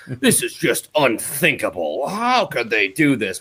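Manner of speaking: mock-dismayed sportscaster; hammed-up broadcaster disbelief; Dan McLaughlin. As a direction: imitate an overwrought TV commentator, drawn out at the end. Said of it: "All right, it's Dan McLaughlin".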